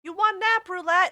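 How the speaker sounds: thrilled for a friend; excited. Real, not acted. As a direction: cheer the news. Reacting to viewer's news.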